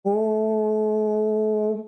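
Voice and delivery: ओम held long and resonant from the belly; drawn-out Om. Shown as a demonstration. Teacher demonstrating pluta on ओम, long drawn-out chant. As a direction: chant ओम slowly, stretching the vowel from the navel. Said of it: "जब ऊपर तक आवाज आएगी".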